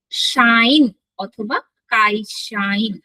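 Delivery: শাইন অথবা কাই শাইন said very slowly; teacher slowly modelling শাইন; slow model words. Shown as a demonstration. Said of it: "models two words".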